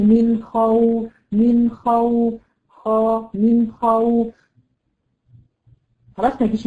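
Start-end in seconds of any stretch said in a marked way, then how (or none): 0.0-4.3 من خوف drawn out with a full, heavy kh; slow, full khaa. Shown as a demonstration. The teacher models the correct word for her student. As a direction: stretch خوف with a thick kh. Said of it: "غير لما تيجي مثلًا".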